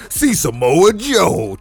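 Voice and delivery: hammed-up monster truck announcer. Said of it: "like a monster truck rally".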